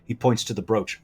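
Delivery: ordinary talk, nothing marked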